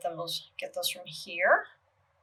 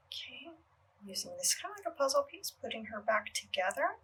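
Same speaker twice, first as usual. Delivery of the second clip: soft unvoiced whisper to herself; 3 semitones higher, 8 dB quieter, faster than usual. Real, not acted. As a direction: whisper it under your breath. Murmurs to herself while working.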